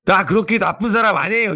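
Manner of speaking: a hint of an offended little kid; mock indignant child. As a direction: play the five-year-old insisting he's not bad. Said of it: "화를 내면서 이러는 거 있죠".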